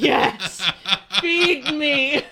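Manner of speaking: high, loud gleeful laugh. Retold or imitated. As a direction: laugh out an eager yes, bring it. Eager reply to scary actors.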